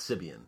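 "Sibian" read as spelt, SIB-ee-an; mistaken name pronunciation. Shown as a demonstration. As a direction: say "Sibian" with an S and a sounded B. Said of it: "I was under the impression it's".

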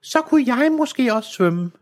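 put-on a little girl dreaming aloud; high young girl voice; child's voice. A narrator's reading. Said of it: "sagde Enola".

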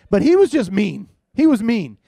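loud, high, emphatic complaint; comic exasperation. Real, not acted. Dad telling crowd about youngest son, loud exasperation. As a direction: insist on it, pushing the voice up.

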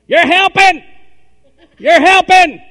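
high, loud holler from the pews; hollering man. Retold or imitated. Preacher imitating a congregant hollering, high and loud. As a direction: yell it out like a man in the crowd. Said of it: "some guy hollered out".

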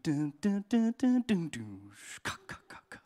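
sung dun-duns swelling high, then whispered ka-ka-ka; imitated movie music. Preacher mimicking the film music as it starts to swell, swelling mock soundtrack. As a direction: mimic a suspense soundtrack with your mouth. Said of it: "then the music starts to swell".

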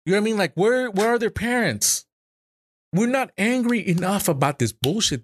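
indignant, pleading questions; real upset in the voice; overwhelmed with anger. Real, not acted. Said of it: "overwhelmed with anger".

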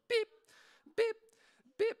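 short, high electronic beeps; imitated scanner beep. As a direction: mimic a checkout scanner, beep by beep. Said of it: "it's like".

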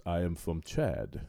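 slow, low imitation of a Chadian accent; imitated Chadian accent. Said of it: "And he goes".

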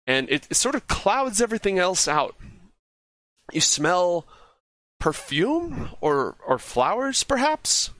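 airy, lilting mysterious narration; eerie narration. A narrator's reading. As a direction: pitch it up and float the words. GM narrates eerie fey wind.

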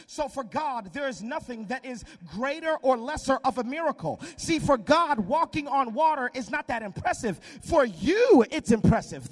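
preacher building again; rising preaching. Acted. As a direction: press the point home.

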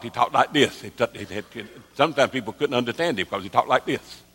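imitating a tongue-tied old preacher; lisping tongue-tied imitation; tongue-tied speech. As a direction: talk thick-tongued, consonants muddled. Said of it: "this is the way he talked".

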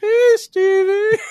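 high, drawn-out call through fake sobs; mock weeping dumb voice. Dying director imitating the tour guide in a high weepy voice. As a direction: cry out the greeting like a dying man's memory. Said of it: "he'll be going".

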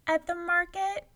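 put-on high small child's voice; little girl. A narrator's reading. Girl answering the giant, high and small.

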